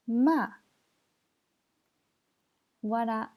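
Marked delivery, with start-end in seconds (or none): 0.1-0.5 "Ma" high and loud, falling fast; fourth tone. Shown as a demonstration. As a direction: drop each "Ma" sharply from high. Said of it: "je répète les quatre tons".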